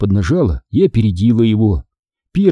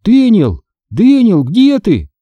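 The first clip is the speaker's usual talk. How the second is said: high, louder call for someone missing; 10 semitones higher, 4 dB louder, slower than usual. A narrator's reading. Girl calls for her vanished brother, anxious shout.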